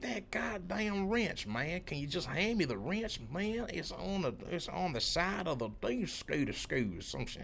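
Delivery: high, exasperated mock country twang; hammed-up country coworker losing patience over a request; deep country accent. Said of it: "I'm talking deep country".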